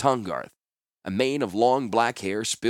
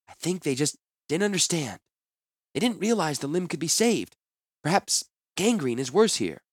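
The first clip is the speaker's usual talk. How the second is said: gentle woman voice; 7 semitones higher than usual. Acted. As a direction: calmly try to soothe. Orm's voice in dialogue.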